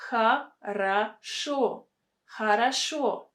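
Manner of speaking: "Хорошо" as ha-ra-SHO, slowly by syllable, then whole; O pronounced as A. Shown as a demonstration. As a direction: say "Хорошо" with two A sounds and a final stressed O. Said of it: "first two O are pronounced as".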